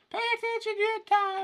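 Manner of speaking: very high squeaky mock preaching; mock nagging voice. Speaker mocking himself as a nag, squeaky high. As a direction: mimic a nagging do-gooder, high.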